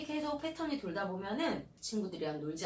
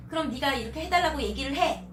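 loud, higher, commanding order; bossy mom order; 7 semitones higher, 10 dB louder than usual. Retold or imitated. Mother ordering her child what to say, loud command. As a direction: tell the child off loudly. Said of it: "라고 시키시면 절대 안 돼요".

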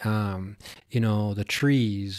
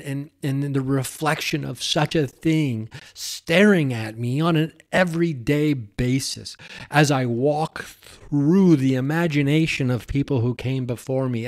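usual speaking voice

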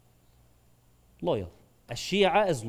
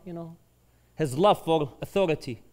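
normal talking voice

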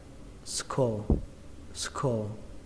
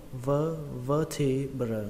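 slow "vertebra", first syllable VƠ isolated then stressed; much slower than usual. Shown as a demonstration. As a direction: lean on the "ver" of "vertebra", slowly. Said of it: "Trọng âm của từ này rơi vào âm đầu tiên". (đọc chậm "vertebra", nhấn âm đầu "vơ")

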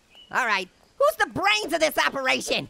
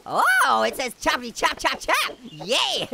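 ordinary talk, nothing marked

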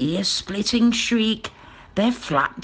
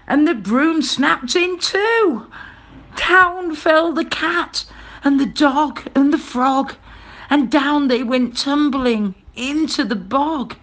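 high, urgent storytelling; excited, raised narrator voice; dramatic narration; 9 semitones higher, 4 dB louder than usual. A narrator's reading. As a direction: tell the tumble with rising alarm. Narrating the broom's dramatic fall.

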